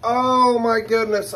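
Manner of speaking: slow thrilled exclamation; excited gasp. Real, not acted. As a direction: burst out with delight over the box. Excited unboxing reaction.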